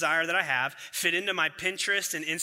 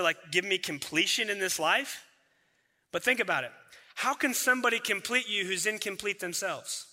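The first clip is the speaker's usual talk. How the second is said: usual speaking voice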